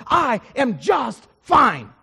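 slow, word-by-word indignant reply; offended, emphatic retort. Acted. Plane passenger rebuffing the preacher's question, slow and indignant. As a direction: spell it out slowly, shutting the question down. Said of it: "he looks at me and he goes".

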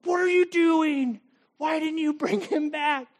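high, slow angry demand; raging mad. Retold or imitated. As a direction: snap at the soldiers like furious priests. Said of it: "like, raging mad".

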